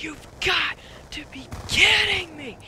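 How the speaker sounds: re-enacted rage, high strained yell; mad outburst. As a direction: draw it out as a loud, exasperated scream. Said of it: "I get so mad".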